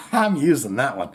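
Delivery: normal talking voice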